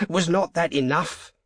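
fierce. A narrator's reading. Old man rebuking his wife over the wish, fiercely. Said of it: "he demanded fiercely".

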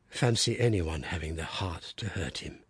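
low, soft muttering under the breath; muttered. A narrator's reading. Father over his injured baby, low soft mutter. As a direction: grieve quietly to yourself. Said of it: "he muttered".

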